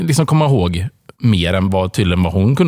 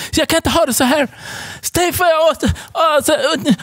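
mock shriek. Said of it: "så bara börjar skrika typ och går".

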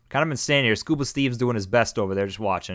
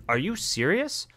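high, slow incredulous question; exasperated rise; 10 semitones higher, slower than usual. Acted. Gym leader fed up with the kid's rock. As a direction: stretch it out in disbelief. Same line retaken higher, slower.

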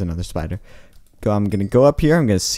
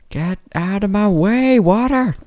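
shrill-pitched yell, much higher and louder; high yell at water; 12 semitones higher, 5 dB louder than usual. Real, not acted. Gamer shouting at water in his way, high yell. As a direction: shout it at the water blocking you.

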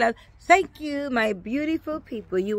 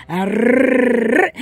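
pirate growl; 7 dB louder than usual. Pretend.

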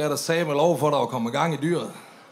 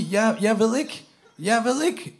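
put-on drunk voice; 6 semitones higher, slower than usual. Mock drunk musing, slowed.

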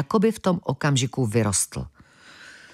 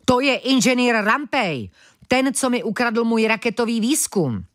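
high-pitched annoyed outburst; irritated snapping; 8 semitones higher than usual. A narrator's reading. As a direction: snap it out in irritation, pitch raised. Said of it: "Mlask podrážděně vyštěkl". (podrážděné vyštěknutí)

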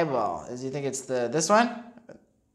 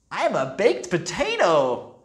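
put-on bright proud child voice; cheerful kid voice; 3 semitones higher, 3 dB louder than usual. A narrator's reading. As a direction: announce the costume proudly. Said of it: "said the brain".

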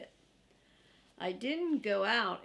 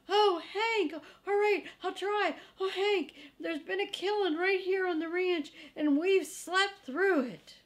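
much higher, louder, whiny little dog; distressed Drover; 9 semitones higher, 4 dB louder than usual. Acted. Drover tells Hank of a killing, high and whining. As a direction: wail the bad news, near tears.

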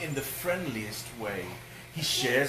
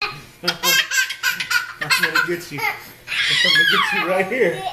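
tickle-chase them as the bear; playful bear threat; 10 dB louder than usual. Acted. Dad playing the bear with the kids, squealing laughing monster voice.

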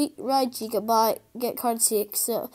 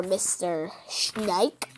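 usual speaking voice

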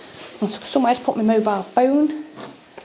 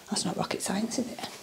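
wry whispered aside; 11 dB quieter than usual. Real, not acted. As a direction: whisper it like a little joke.

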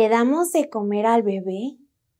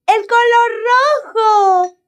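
much higher and louder, thrilled; excited call; 11 semitones higher, 6 dB louder than usual. Acted. Playing the red bar for the children, high and excited. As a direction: burst out with delight.

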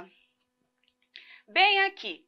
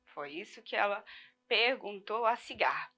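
whisper; 4 semitones lower, 9 dB quieter than usual. Shown as a demonstration.

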